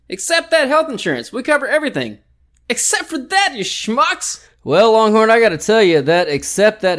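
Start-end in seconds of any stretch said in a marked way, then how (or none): 0.1-4.5 squeaky mock jingle-style tagline; high-pitched ad slogan.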